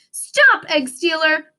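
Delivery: loud, high shout; yelled. A narrator's reading. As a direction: shout to stop the thief. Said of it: "they yelled".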